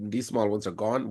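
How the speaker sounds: ordinary delivery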